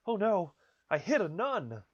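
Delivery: high, loud, slow mock scream of horror. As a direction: shriek it as the punchline. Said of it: "runs out of the bar and screams".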